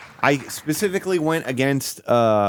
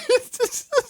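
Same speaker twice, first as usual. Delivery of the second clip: squeal of laughter. Real, not acted. Cracking up.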